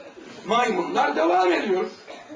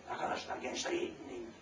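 hushed aside; 13 semitones lower, 15 dB quieter, faster than usual. Real, not acted. Preacher whispering to the youngsters to listen. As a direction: lean in and whisper to them.

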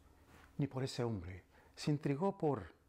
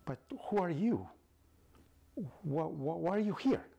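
startled; 6 semitones higher, 4 dB louder than usual. Acted. Einstein startled by stranger.

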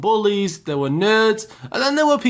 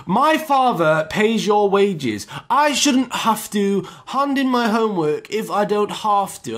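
slow, haughty higher-pitched snob voice; arrogant rich kid; 4 semitones higher, slower than usual. Retold or imitated. Rich kid talking back to a teacher over homework, arrogant. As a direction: draw it out like a spoilt snob. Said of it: "who took being arrogant to the next level".

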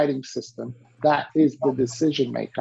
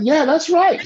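eager agreement; 11 semitones higher, 5 dB louder than usual. Retold or imitated.

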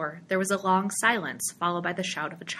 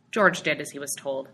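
usual speaking voice

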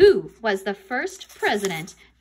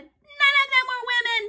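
very high, loud outcry; high-pitched indignant shout; shouted protest; 5 dB louder than usual. A narrator's reading. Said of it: "Andrea shouted".

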